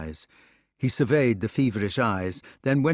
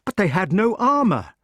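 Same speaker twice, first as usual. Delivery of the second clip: high, loud shocked protest; king protesting in shock; 10 semitones higher, 6 dB louder than usual. Acted. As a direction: protest in disbelief. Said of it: "protested the king".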